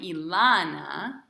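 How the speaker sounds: "Ilana" slowed, stressed on the middle LA; "Ilana" as i-LAAA-na, falling at the end; stress on the first A. Shown as a demonstration. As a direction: draw out the LA in "Ilana". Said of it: "on the first A".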